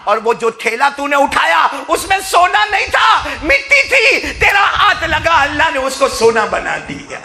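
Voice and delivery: high, loud impassioned preaching; fiery Urdu sermon storytelling; preaching at full force. Acted. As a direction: drive the story home at full cry.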